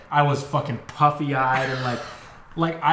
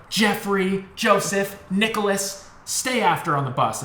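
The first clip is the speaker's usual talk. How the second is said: higher, name-by-name mock scolding; retold teacher's yell; 7 semitones higher, slower than usual. Teacher singles out kids on the bus, mock yelling. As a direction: call out each name like a cross teacher. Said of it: "she yelled at us".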